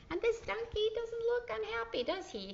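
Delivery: high, teasing question; a light singsong tease; playful high voice. Acted. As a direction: tease the kids about the donkey. Playful aside about the donkey.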